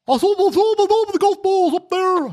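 put-on Scottish accent. Host shows off his Scottish impression to guests, high hammed-up Scottish accent. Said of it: "I can do a Scottish one".

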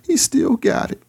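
mock-proud gym boast; cocky self-talk. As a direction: crow about still having it. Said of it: "I was like".

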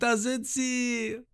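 high, slow, stretched mock yell; mock shout. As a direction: call it out as a high-voiced creature. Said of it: "Und Abi schreit". (hoher, gedehnter gespielter Ruf)

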